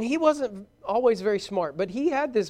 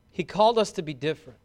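usual speaking voice

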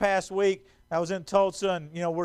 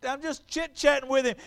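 ordinary talk, nothing marked

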